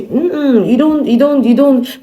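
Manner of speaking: loud, high insistent denial; emphatic no. Acted. Scolding viewers, repeated denial.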